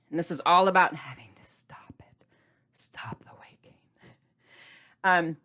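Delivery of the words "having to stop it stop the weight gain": breathy stage whisper. Acted. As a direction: whisper it like a secret warning. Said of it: "sudden whisper".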